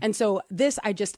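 usual speaking voice

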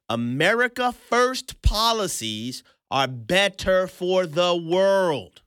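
America First policies are better for the world drawn out word by word, world stretched; slow emphasis. Shown as a demonstration. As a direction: lean on every word, slowing to a crawl at the end. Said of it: "I'm gonna say that again".

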